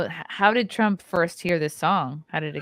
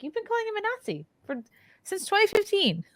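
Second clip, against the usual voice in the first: high, squeaky disbelief; quick, incredulous high-pitched protest; 13 semitones higher than usual. Real, not acted. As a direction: voice shoots up in disbelief. Incredulous retort to hypocritical critics.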